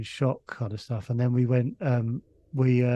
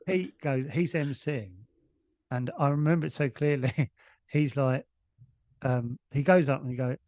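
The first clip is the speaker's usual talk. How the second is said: ordinary talk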